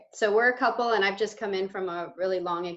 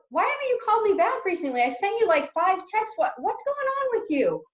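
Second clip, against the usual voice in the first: upset complaint; 9 semitones higher than usual. Acted. Role-play friend complaining.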